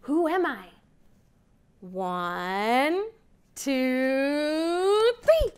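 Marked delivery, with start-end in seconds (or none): 1.8-5.5 building countdown. Acted. Teacher counting to children, building to an excited three.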